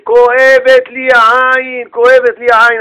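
stretched, loud lament; public complaint of pain. Acted. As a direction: call out your ailment for the whole town. Announcing eye ache around town.

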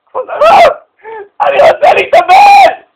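wailing at full volume, pitch way up; desperate shout. Retold or imitated. Young man wails to the rabbi in despair, shouting. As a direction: cry out like a broken man begging for help.

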